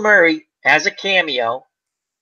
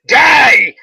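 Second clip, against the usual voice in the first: loud, high shouted Die!; mock yell; 7 semitones higher, 7 dB louder than usual. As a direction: bark it like a crazed sniper. Quoting sniper yelling at target.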